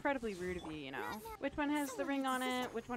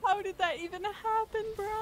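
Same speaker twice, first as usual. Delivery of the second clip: loud, rising panic; panicking; 3 semitones higher, 6 dB louder than usual. Real, not acted. Gamer panicking after losing her best healer. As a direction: fret loudly as things go wrong. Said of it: "I'm gonna start panicking".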